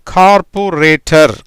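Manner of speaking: கார்பொரேட்டர் drawn out syllable by syllable; teacher's slow model of கார்பொரேட்டர்; slow sounding-out. Shown as a demonstration. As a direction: say கார்பொரேட்டர் slowly, each part separate. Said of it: "எப்படி வருது?".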